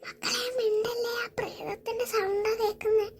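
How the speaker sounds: put-on urgent whisper to the kids; mother whispering to her children to stay silent. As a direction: hush them, barely voiced. Hushing children from ghost.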